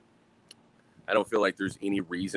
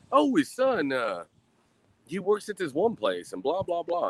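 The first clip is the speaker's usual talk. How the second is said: higher, lightly voiced little kid; a light hint of the kid's voice, higher; 9 semitones higher than usual. Retelling what the neighbour's little kid said, higher lightly voiced kid.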